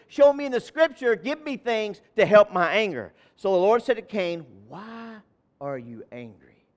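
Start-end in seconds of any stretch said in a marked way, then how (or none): 4.7-6.6 much softer, gentle question; soft voice of God. Acted. The Lord quietly asking Cain, soft and gentle. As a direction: ask it hushed and searching.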